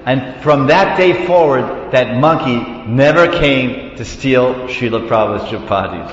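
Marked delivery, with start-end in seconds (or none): none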